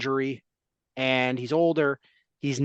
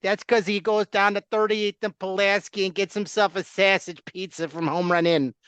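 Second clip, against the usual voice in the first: put-on laughing Chicago accent, higher and louder; 6 semitones higher, 3 dB louder than usual. Host mocking Bears fans in a loud Chicago accent. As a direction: play a beefy Chicago superfan. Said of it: "I can't even do a Chicago accent".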